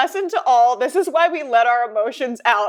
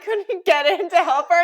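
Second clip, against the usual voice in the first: ordinary delivery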